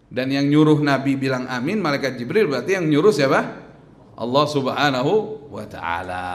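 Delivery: ordinary talk, nothing marked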